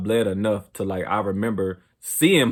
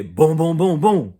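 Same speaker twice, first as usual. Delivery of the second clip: loud, higher pounding booms; the knock comes again, louder; imitated police knock; 5 semitones higher, 5 dB louder, slower than usual. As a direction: pound out each boom like a fist on the door. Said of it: "So I hear".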